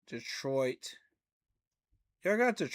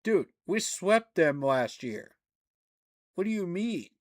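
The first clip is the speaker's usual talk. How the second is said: indignant; 4 semitones higher, 6 dB louder than usual. Real, not acted. Arguing with ESPN rankings.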